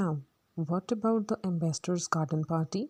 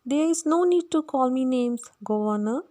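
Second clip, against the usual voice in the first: put-on higher, louder Cockney girl's voice; Eliza; 6 semitones higher, 4 dB louder than usual. Eliza protests at Higgins calling her a ninny hammer, high and loud. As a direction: bristle with high-pitched indignation.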